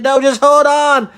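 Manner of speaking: high, very loud command; urgent shout. Acted. Buttercup steadying Yodi mid-dive, urgent loud shout. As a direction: holler it over the panic.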